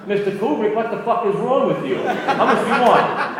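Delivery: high, loud furious yell with laughter in it; angry yell. Retold or imitated. Retold actor yelling at the director, loud and furious. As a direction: blow up at the director, half laughing as you tell it. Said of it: "he was getting mad".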